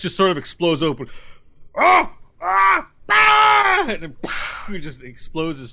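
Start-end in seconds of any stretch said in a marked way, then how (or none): 1.8-3.8 much higher, louder rising screams; three shrieks climbing in pitch; bugbear death screams. Acted. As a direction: scream out as the monster's chest bursts. Bugbear dying as chest explodes.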